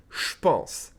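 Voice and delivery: "je pense" merged into "shpense"; blended words. Shown as a demonstration. Teacher blends two words for learners, "je pense" as "shpense". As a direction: say "je pense" as one word starting with "sh". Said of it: "blend those two words together".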